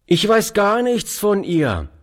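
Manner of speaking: put-on high, friendly boyish voice. A narrator's reading. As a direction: answer kindly as the boy. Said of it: "antwortete der fremde Junge freundlich".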